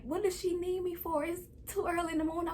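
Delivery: put-on high, fast whiny child voice; sleepy kid complaining. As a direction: whine like a grumpy third grader woken too early. Third-grade self whining at dad.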